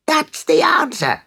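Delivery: high delighted cry; excited cry. Acted. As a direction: cry out in glee. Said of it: "cried the town clerk".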